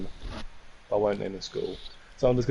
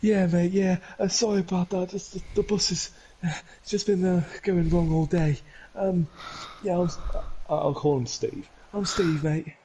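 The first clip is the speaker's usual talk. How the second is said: higher, nervous apologetic voice; scared, lost coach; 7 semitones higher than usual. Retold or imitated. Flustered coach apologising to Keane for running late, higher voice. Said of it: "he sounded so scared and lost".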